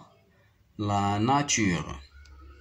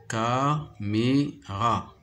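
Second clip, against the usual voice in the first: "caméra" slowly split as ca, mé, ra; syllable by syllable; much slower than usual. Shown as a demonstration. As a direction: say "caméra" in three separate beats. New word broken into syllables.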